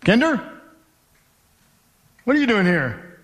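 surprised colleague. Retold or imitated. Coworker spotting him in the bank, mild surprised call. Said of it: "I hear someone say my last name".

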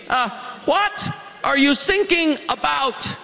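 put-on slow, puzzled German accent; confused German operator. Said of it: "German Coast Guard".

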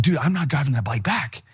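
ordinary talk, nothing marked

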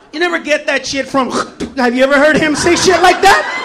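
normal talking voice

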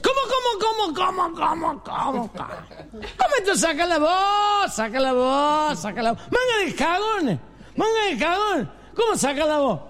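put-on high, drawn-out drunken protest; blustering drunk. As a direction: whine and bluster indignantly like a comic drunk. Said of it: "Y un borracho dice". (borracho indignado, agudo y arrastrado)

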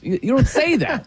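ordinary talk

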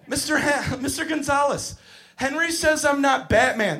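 put-on high-pitched little-kid voice; complaining preschooler. Small boy telling Mister Gonzalez on Henry, complaining. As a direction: tattle to the teacher in a child's voice. Voicing a child complaining to teacher.

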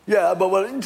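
a grumbler trying to cut in; a crowd member talking back to Moses; protesting crowd. Acted.

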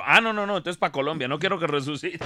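high, hasty comic protest breaking into laughter; mock alarm. Joke's son-in-law refuses burial in Tierra Santa, mock alarm. Alarmed punchline after hushed joke set-up.

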